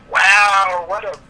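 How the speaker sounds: high, loud burst of surprise; surprised wow. Real, not acted. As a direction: blurt it out at the big play. Big play reaction.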